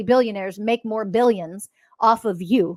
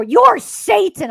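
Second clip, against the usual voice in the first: high, loud, slow mock horror; mock accusers; 11 semitones higher, 9 dB louder, much slower than usual. Imitating people who call her Satan, high exaggerated horror. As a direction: stretch out a hammed-up, shrieked accusation. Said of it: "I love the when people say".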